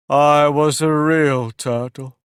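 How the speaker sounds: deep sigh. A narrator's reading. Mock Turtle to Alice, slow and mournful. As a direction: sigh out a sad confession. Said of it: "with a deep sigh".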